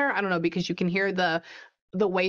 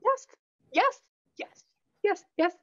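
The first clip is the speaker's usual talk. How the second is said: much higher, eager yeses; excited agreement; 12 semitones higher than usual. Real, not acted. Medium confirms the sitter's story.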